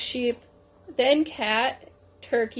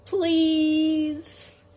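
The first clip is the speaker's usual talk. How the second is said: higher, drawn-out please; silly pleading please; 4 semitones higher than usual. A narrator's reading. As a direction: plead with a cheeky smirk. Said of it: "with two silly smirks and said".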